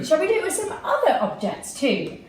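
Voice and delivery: ordinary delivery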